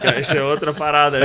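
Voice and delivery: a real laugh breaks into the line. Real, not acted. After the dinner-time joke, speaking while laughing.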